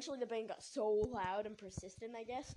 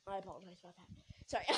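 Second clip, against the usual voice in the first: ordinary talk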